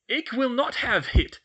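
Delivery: loud, high archaic refusal; indignant portrait. Acted. Medieval portrait rejects the pupil's news, loudly indignant. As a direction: refuse outright in an old-world accent.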